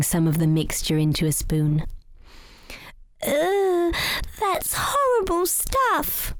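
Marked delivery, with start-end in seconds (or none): 3.2-6.3 put-on high, slow child voice in disgust; disgusted little rabbit. A narrator's reading. Young rabbit tasting medicine, high disgusted groan. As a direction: scrunch up your face and groan it, high and drawn out. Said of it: "cried Barnaby, screwing up his face in disgust".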